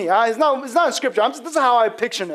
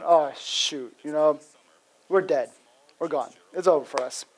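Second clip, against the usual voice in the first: low, deflated voice, softening as it goes; acted resignation; 6 semitones lower, 4 dB quieter than usual. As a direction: sink into quiet, mock-doomed defeat. Imagines doomed spies reacting.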